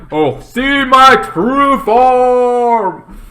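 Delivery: high, loud, very slow menacing boast; villain proclamation. Retold or imitated. Villain showing her true form, slow high boast. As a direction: stretch it out like a monster unveiled.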